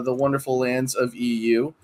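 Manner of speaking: ordinary talk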